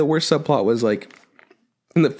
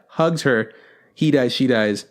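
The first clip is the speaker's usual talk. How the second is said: normal talking voice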